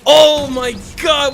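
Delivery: stunned, drawn-out oh my god; amazed shout. Real, not acted. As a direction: shout it in disbelief. Reacting to the play.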